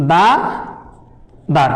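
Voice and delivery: "দাদার" stretched very slowly, each syllable held long; drawn out slowly. Shown as a demonstration. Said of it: "তো দাদার যদি ভাঙি".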